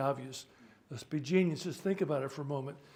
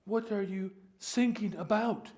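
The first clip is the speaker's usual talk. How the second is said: put-on light German accent, higher; a lightly done German officer; 5 semitones higher than usual. New German Coast Guard communicator replies, light German accent. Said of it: "I can't do it nearly as well as the guy does".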